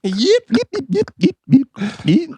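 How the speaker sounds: high alien chatter. Pretend. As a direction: chirp it like an alien. Playing aliens talking.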